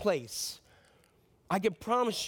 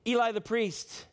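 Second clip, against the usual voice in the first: usual speaking voice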